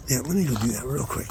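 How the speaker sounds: ordinary talk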